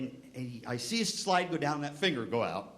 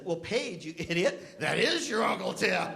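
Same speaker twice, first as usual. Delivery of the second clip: raised, higher-pitched exasperation; exasperated mock scolding; 7 semitones higher, slower than usual. Mother sets her daughter straight about Uncle Tim, exasperated. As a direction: scold a clueless child, half laughing. Scolding grandniece in retold family story.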